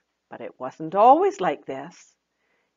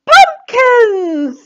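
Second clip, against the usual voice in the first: much higher, louder bright shout; eager answer; 13 dB louder than usual. Acted. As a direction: blurt out the answer proudly. Autumn guesses the answer.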